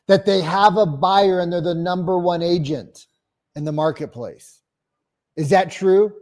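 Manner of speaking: confident cold-call energy; phone pitch demo. Shown as a demonstration. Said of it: "But do you hear how the delivery is?".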